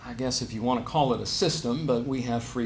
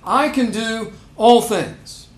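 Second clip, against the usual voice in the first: 크고 느리게 "all"을 강조한 잘못된 인용; "I can do all things"를 힘차게 또박또박; emphatic misquote; 6 semitones higher, 6 dB louder, slower than usual. Shown as a demonstration. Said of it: "But that's not Paul's point".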